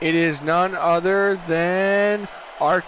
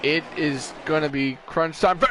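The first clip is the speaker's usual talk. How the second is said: ordinary talk, nothing marked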